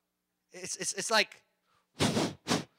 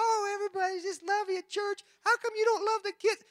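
much higher mock kid voice; whiny child imitation, pitched way up; 12 semitones higher than usual. Kids complaining to their parent, in a high mock whine. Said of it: "then they call".

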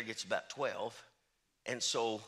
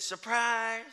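high, louder gleeful reveal; playful surprise; 9 semitones higher, 6 dB louder than usual. Retold or imitated. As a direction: announce the gift with glee. Donor reveals the gift.

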